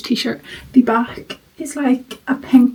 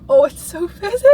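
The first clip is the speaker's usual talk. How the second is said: higher, brighter delight; 8 semitones higher, 4 dB louder than usual. Real, not acted. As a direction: gush over the fizz. Delighted by drink fizz.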